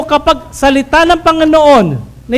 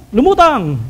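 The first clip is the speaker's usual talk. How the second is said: usual speaking voice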